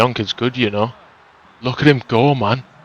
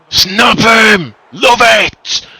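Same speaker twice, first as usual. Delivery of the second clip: yelling from the sidelines; 12 semitones higher, 8 dB louder, much slower than usual. Real, not acted. Cheering a hard tackle.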